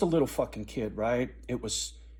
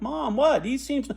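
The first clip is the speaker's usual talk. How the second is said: put-on confused kid; 12 semitones higher, 4 dB louder than usual. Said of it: "I'm like".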